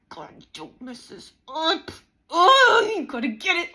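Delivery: mock trembling pep talk, then a sudden high loud "Oh"; nervous self-pep talk. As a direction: imitate your shaky self urging yourself on. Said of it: "And I would be trembling".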